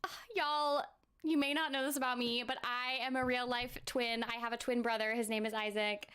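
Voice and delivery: ordinary talk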